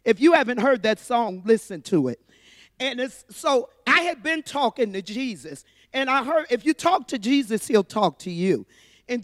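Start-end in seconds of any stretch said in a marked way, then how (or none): none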